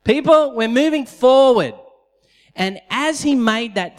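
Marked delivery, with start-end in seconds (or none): 0.0-1.7 Moses calling out. Retold or imitated. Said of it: "Moses says".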